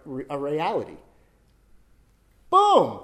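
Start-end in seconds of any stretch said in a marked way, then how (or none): 2.5-3.0 high, very loud burst; loud demonstrative pop; sudden exclamation. Shown as a demonstration. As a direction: boom it out like a magician's reveal. Makes the fist reappear.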